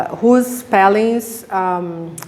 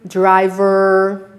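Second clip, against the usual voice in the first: ordinary delivery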